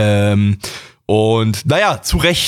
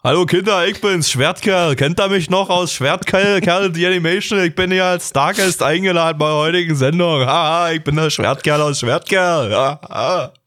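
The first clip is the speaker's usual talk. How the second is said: goofy muffled sock puppet; 5 semitones higher than usual. Pretend. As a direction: play a silly kids' show puppet. Guest voice as Schwertkerl character. (alberne Puppenstimme)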